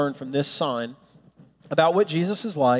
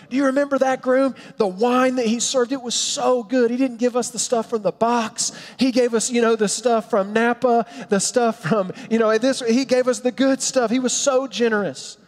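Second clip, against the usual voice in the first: high-pitched excited gossiping guest; acted raving; 7 semitones higher than usual. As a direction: rave like an impressed wedding guest. Community raving about the groom's party.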